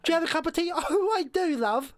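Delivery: high, hammed-up posh English voice; posh Doctor Who. Posh Doctor taking tea, high put-on voice. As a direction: pretend to be terribly upper-class over tea. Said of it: "I would like to have a posh Doctor Who".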